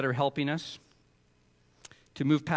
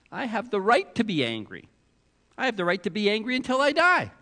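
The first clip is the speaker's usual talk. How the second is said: higher, louder indignant protest; angry Jonah; 7 semitones higher, 5 dB louder than usual. Retold or imitated. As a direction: hammer out the defiance. Jonah's angry claim voiced.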